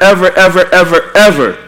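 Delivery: each ever hit hard and falling; emphatic repetition. Real, not acted. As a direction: hammer the word ever each time. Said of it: "I could say never".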